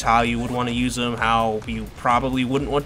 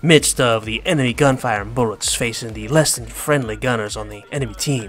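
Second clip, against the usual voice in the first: Team Fortress Two engineer. Retold or imitated. Explaining the engineer class to viewers, in a Texan engineer voice. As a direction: play the game's engineer bragging about his job. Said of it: "aside from the Team Fortress Two references".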